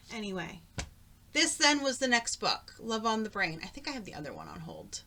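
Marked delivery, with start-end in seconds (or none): none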